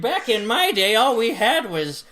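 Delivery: put-on cranky old man grumbling; grumpy grandpa scolding kids. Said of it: "I wish I had a better old man voice".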